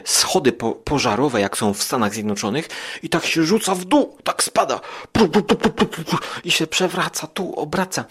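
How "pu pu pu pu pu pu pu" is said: high, loud pu-pu sounds of falling; imitated tumbling noise. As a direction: mimic something bouncing down stairs. Imitating creature falling down stairs.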